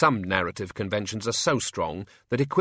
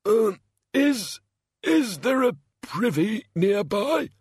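high, slow queasy mumble; mumbled; 11 semitones higher, 3 dB louder, slower than usual. A narrator's reading. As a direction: mumble it, feeling sick. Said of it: "mumbled their burden through clammy lips".

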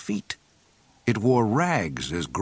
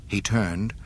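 plain reading voice

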